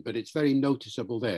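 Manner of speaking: ordinary talk, nothing marked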